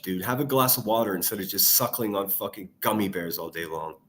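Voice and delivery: ordinary delivery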